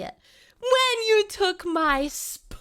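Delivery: dramatic mock sob; mock wail. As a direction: stretch it out in fake grief. Mock heartbreak over spoon.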